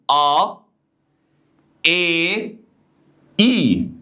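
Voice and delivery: "ا، ايه، اي" as separate "ah, eh, ee"; "ا، ايه، اي" drawn out as three distinct vowels; vowel contrast demo. Shown as a demonstration. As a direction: hold each vowel apart: "ا" ah, "ايه" eh, "اي" ee. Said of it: "تعالى كده بص معايا".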